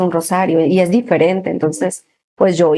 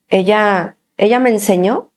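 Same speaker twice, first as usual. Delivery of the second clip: usual speaking voice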